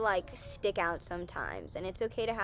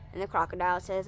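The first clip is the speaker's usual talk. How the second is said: ordinary delivery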